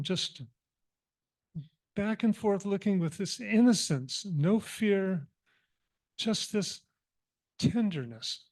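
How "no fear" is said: ordinary delivery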